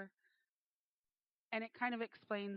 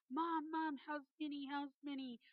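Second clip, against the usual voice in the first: put-on higher-pitched voice of an excited boy; eager child; 5 semitones higher than usual. Oldest boy runs upstairs asking about the dog, eager child. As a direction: imitate a kid urgently asking.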